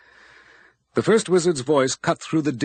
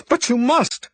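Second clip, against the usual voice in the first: high, loud shouting plea; desperate shout; 13 semitones higher, 6 dB louder than usual. A narrator's reading. Abby shouts her plea at the wizard. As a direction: shout it at him.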